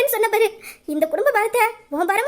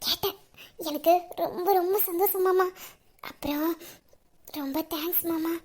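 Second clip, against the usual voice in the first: soft tearful; 4 semitones lower, 9 dB quieter than usual. Acted. Bride overwhelmed, softly thanks fiancé.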